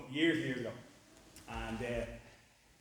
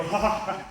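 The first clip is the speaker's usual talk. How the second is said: mock laugh; 6 semitones higher, 10 dB louder than usual. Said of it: "And you go".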